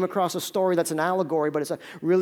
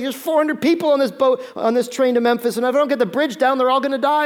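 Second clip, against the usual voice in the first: panicked; 6 semitones higher, 4 dB louder than usual. Retold or imitated. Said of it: "he's panicked".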